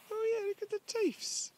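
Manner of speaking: "look at the teeths" in a very high, slow, silly voice; squeaky put-on voice. Owner teasing her horse about his teeth, high squeaky voice. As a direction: tease the horse in a high cartoon voice. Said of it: "Oh, yeah, look at the teeths".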